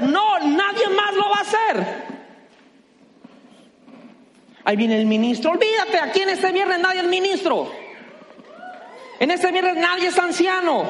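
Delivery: high, loud, fired-up pastor's preaching; rally the church from the pulpit; preaching at full force. Acted. Pastor rousing his Friday congregation, full-force preaching.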